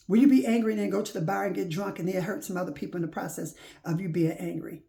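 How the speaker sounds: ordinary talk, nothing marked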